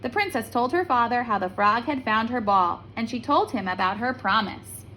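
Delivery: usual speaking voice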